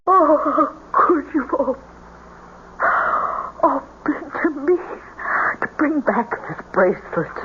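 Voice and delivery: broken, crying mother; weeping. Acted. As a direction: cry through every word. Mother weeps after the seance.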